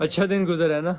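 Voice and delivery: loud, high, overdone cheer; hammed-up loud small talk; acting cheerful. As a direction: talk over them loudly as if no one else is there. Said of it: "acting کرتے رہنی ہے".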